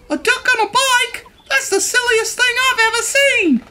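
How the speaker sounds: very high-pitched cow voice; incredulous cow. A narrator's reading. Cow thinking about Duck on a bike, high incredulous voice. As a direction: squeal it in disbelief as the cow. Cow's scornful thought, acted.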